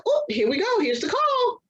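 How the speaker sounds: thinking she is being called back; excited expectation. Retold or imitated. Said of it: "I'm like".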